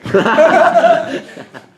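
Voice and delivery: loud, high burst of laughter; big laugh. Real, not acted. Speaker laughing at getting fired, loud laugh. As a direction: laugh hard at your own punchline. Laughs at his own punchline.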